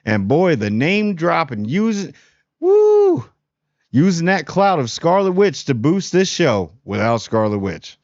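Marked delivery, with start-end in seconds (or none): 2.6-3.2 high, loud drawn-out "woo"; whoop. Real, not acted. Reviewer exclaiming over the show's name dropping, high whoop. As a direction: let out a sudden falsetto-high whoop.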